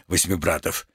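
ordinary talk

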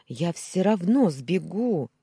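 loud, slow, strained cry; a captive girl crying out defiantly at her captor; anguished cry. Acted. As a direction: throw it after him, voice straining. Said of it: "с надрывом крикнула я".

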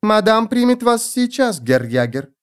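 thin, high-pitched maid's voice. A narrator's reading. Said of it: "раздался за спиной тонкий голос". (тонкий высокий голос служанки)

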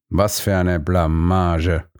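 low, slow lament; mild moan of embarrassment. A narrator's reading. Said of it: "jammerte er".

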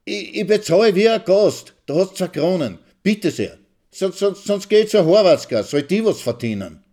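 very high, loud, stuttering rage; husband offering money in anger; heated stammering. Acted. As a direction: sputter it out, furious.

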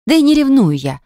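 high, loud protest; indignant denial. Acted. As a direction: insist hotly you are not jealous. Denying jealousy.

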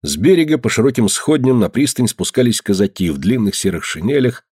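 ordinary delivery, nothing marked

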